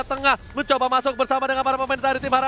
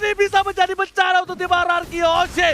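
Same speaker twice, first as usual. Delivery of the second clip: excited; 8 semitones higher, 5 dB louder than usual. Real, not acted. Caster calling the fight live.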